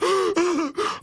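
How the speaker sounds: mock panicked gasp, high; hyperventilating. Imitating police panicking at a checkpoint, hyperventilating gasp. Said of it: "start hyperventilating on video going".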